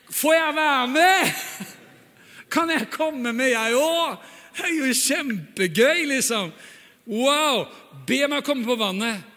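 slow, high, pleading and thrilled; eager Peter. Acted. Peter begging Jesus to let him walk, high and eager. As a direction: beg like a child wanting to play. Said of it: "Peter begging to join".

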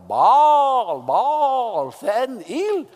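hammed-up cry to a deaf god; mock shouting to Baal. Elijah mocking Baal's prophets by calling out loud. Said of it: "begynner han å håne dem".

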